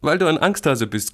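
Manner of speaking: high, loud sibling taunt; teasing. Acted. Sister calls brother a scaredy-cat, teasing. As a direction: needle the little one, pitched high.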